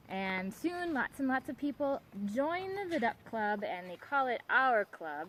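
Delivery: usual speaking voice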